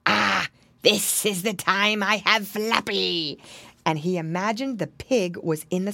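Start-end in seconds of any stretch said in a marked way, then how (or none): 0.0-3.4 slow, triumphant fox voice; drawn-out villainous fox cry. A narrator's reading. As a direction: gloat like a fox with its catch. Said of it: "crying out".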